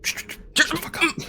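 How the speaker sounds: harsh whispered order to shut up; whispered NPC command; hissed whisper. Acted. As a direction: spit it out under your breath, high and tense. GM voicing NPC, hushed order.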